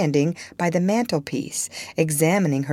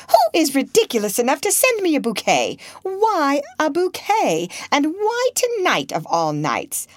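high-pitched, exasperated outburst; shrill indignation; 8 semitones higher than usual. A narrator's reading. Lady indignant at being sent a bouquet, shrill. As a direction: protest shrilly, pitch leaping up on the questions. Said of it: "exclaimed in a shrill voice".

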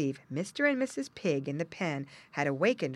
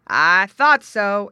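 loud, slow, higher exclamation; exclaiming; 4 semitones higher, 14 dB louder, much slower than usual. A narrator's reading. Farmer sees the hole, exclaiming. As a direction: proclaim it as a suspicion confirmed. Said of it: "exclaimed the farmer".

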